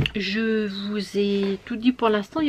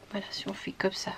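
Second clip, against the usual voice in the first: whisper; 9 dB quieter, faster than usual. Real, not acted. Concentrating on a stitch.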